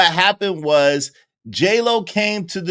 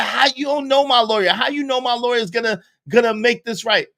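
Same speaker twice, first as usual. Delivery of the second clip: much higher, shrill-edged panic; disbelieving, near hysterics; frantic J-Lo; 8 semitones higher, faster than usual. Retold or imitated. [name] demanding answers from [name], high and frantic. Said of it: "She said".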